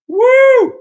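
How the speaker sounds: loud, very high "woo"; excited whoop. Real, not acted. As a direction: let out a sudden cheering whoop. Cheering on the stream.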